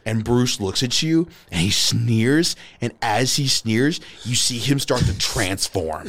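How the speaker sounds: slow, emphatic storytelling as a villain transforms; dramatic game master narration. Acted. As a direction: stretch out the reveal for suspense. Game master's dramatic scene setting.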